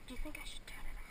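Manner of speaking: hushed unvoiced question; whispering. Real, not acted. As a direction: whisper it behind the camera. Whispering aside behind camera.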